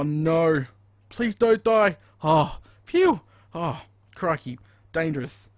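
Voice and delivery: ordinary talk, nothing marked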